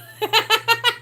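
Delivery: loud, high burst of laughter. Real, not acted. Streamer laughs off getting emotional, high laugh.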